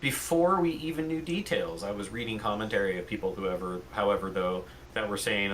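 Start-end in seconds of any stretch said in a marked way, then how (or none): none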